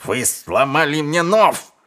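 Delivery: slow, high angry yell; outraged over a broken nose; strained, pained outcry. A narrator's reading. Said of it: "заорал Низ".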